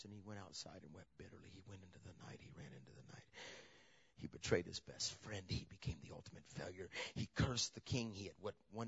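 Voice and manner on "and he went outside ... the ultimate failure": drops to a breathy near-whisper, much lower; hushed preacher whisper. Real, not acted. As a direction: let it fall to a whisper as Peter flees.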